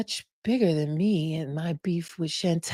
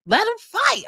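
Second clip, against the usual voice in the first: yelling let em fight. Retold or imitated. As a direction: egg them on, hyped yell. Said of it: "bedroom yelling".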